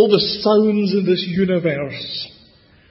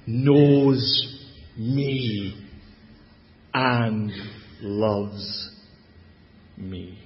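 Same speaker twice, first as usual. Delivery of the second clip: softer and lower, slowing to a near hush on "me"; tender hush; 8 semitones lower, 5 dB quieter, much slower than usual. Acted. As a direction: let it sink gently, like a confession. Closing line of sermon point.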